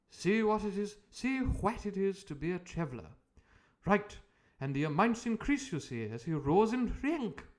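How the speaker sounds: pleased with his own cleverness; eager doctor. Acted.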